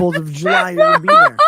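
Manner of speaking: loud comic yell breaking into laughter; hammed-up shouting. Ranting to the stream about corn chips, laughing yell. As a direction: rant at the top of your lungs, laughing.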